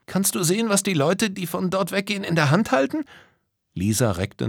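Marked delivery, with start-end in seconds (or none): 0.1-3.0 high-pitched, curious question; high voice, rising to a question; curious character. A narrator's reading. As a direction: ask eagerly in a high little voice.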